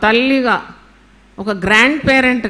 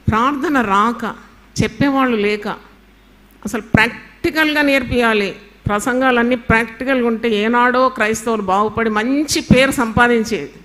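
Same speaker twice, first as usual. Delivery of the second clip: usual speaking voice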